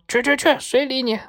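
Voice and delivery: high parrot voice shooing someone off; dismissive parrot. A narrator's reading. Parrot brushes off the visitor, high and dismissive. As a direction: wave them away in a high parrot voice. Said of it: "绿鹦鹉说".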